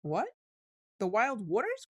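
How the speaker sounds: high, startled cry; trembling cry. A narrator's reading. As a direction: cry out, shaking all over. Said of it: "cried Toad, trembling in every limb".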